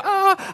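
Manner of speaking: high, loud "ah" of alarm; mock panicked scream. Preacher voices us going downhill, mock scream. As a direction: cry out in pretend panic. Acts people panicking once more.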